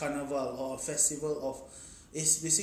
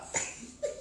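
short laugh. Real, not acted. Laugh after mock quote.

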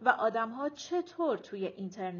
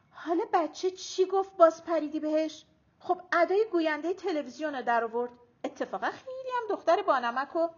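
scolding; 6 semitones higher, 6 dB louder than usual. A narrator's reading. Grandmother defends child against mother.

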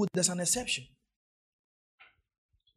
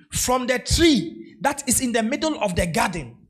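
preaching at full force; 2 semitones higher, 4 dB louder than usual. Acted. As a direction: preach it hard to the congregation.

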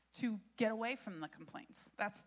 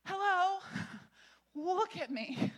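higher, louder, incredulous angel voice; exasperated angel; 7 semitones higher, 3 dB louder than usual. Acted. Pastor voicing the angel scolding Zechariah, exasperated. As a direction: play the offended angel who can't believe it. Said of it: "And the angel's like".

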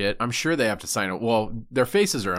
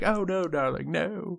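tender consoling; 9 semitones higher, slower than usual. Retold or imitated. Chef to the little kids on his show, sweetly soothing. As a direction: coo it gently, as to an upset child. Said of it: "The little kid's, he's like".

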